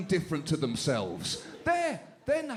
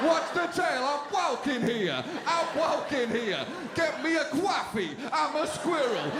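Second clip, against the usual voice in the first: put-on high, brash Jersey American accent; a pushy New Jersey American accent, raised pitch; foul-mouthed Jersey squirrel; 7 semitones higher than usual. Comic plays a rude squirrel in a Jersey American accent. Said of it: "a foul-mouthed squirrel from Jersey".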